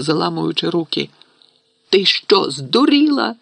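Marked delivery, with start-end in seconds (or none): 1.9-3.3 high, incredulous yell; angry roar. A narrator's reading. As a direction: bellow it in disbelief. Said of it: "заревів Рон".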